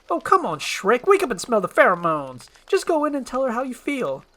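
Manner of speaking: high, loud coaxing donkey; exasperated urging. Acted. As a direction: push a stubborn friend to own up. Donkey urges Shrek to confess.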